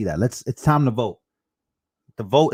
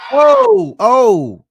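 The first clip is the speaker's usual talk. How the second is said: high, loud, excited oh oh; excited yelp; 12 dB louder than usual. Real, not acted. Host cheering Trump's no to a debate, excited loud yelp.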